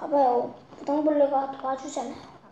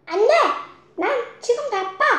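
higher, curt refusal; greedy pig refusal; 6 semitones higher, 4 dB louder, faster than usual. Acted. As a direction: brush them off impatiently.

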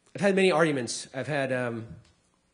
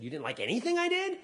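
high-pitched incredulous question; acted disbelief; 10 semitones higher than usual. Comedian answering a woman who disliked his show, acted incredulous. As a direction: replay being taken aback, pitch jumping up.

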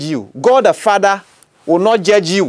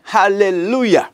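ordinary delivery

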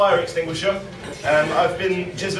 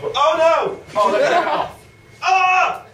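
higher, louder hammed-up panic; mock alarm; 8 semitones higher, 3 dB louder, slower than usual. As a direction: cry out to Dave in theatrical dismay. Improv discovery of stuck mishap.

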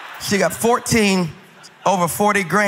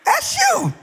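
put-on old grandma; much slower than usual. Grandma who knows him by his speech, calling out very high. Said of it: "and she was like".

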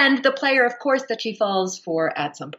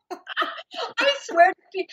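laughing hard, voice pitched high and slow; 13 semitones higher, slower than usual. Real, not acted. Laughing while telling the story.

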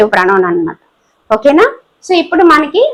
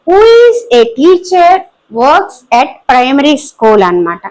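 slow dictation; much slower than usual. Shown as a demonstration. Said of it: "teacher dictates example sentence".

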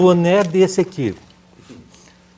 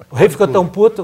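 louder retake; 3 semitones higher, 7 dB louder than usual. Shown as a demonstration.

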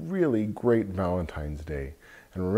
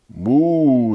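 cow moo; 4 semitones higher, 6 dB louder than usual. Acted. Cow moos in picture book.

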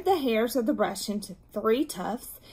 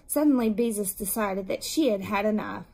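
ordinary delivery

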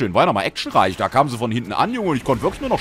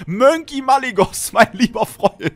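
high, slow goofy shout-out; silly greeting voice; 12 semitones higher, 6 dB louder, slower than usual. Acted. Streamer greeting a friend, high goofy drawn-out voice. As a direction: stretch the name out playfully high.

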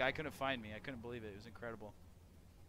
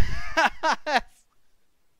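high, loud burst of laughing; ecstatic laughter; 12 semitones higher, 16 dB louder than usual. Real, not acted. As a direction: laugh out in pure joy. Laughing after the win.

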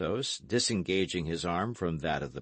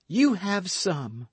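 slow, pitched-up eager cry; eager outburst; 12 semitones higher, 4 dB louder, slower than usual. Acted. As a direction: blurt it out before she can stop herself. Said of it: "in a transport that surprised even herself".

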